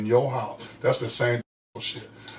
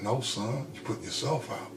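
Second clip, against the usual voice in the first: softer, calm and steady, no emotion; a mother gently putting her son out; calm quiet mother; 13 dB quieter than usual. Retold or imitated. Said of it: "calm, cool, and collected".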